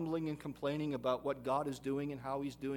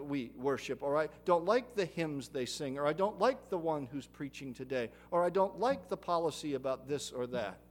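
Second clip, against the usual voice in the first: louder mock grumbling of excuses; whiny complaints; 6 dB louder than usual. Preacher mimicking churchgoers' gripes, louder and mocking. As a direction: rattle off petty gripes in a mocking tone.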